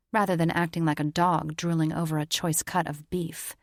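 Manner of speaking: usual speaking voice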